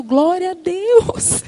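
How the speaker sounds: high, drawn-out hallelujah; mock relieved praise. Wife hearing husband's good report, playfully relieved.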